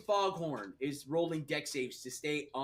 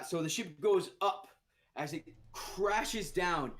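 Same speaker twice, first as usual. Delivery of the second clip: ordinary delivery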